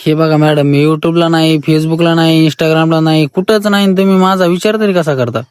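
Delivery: usual speaking voice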